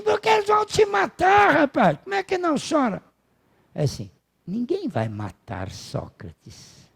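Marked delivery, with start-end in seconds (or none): 0.0-3.0 high-pitched, wailing protest, imitated; mock-hysterical wife, voice leaping way up; distraught wife. Wife crying, protesting to Socrates. 4.5-6.9 low, soft, unhurried reply; calm philosopher's hushed, drawn-out assurance; serene Socrates. Retold or imitated. Voicing serene Socrates answering wife.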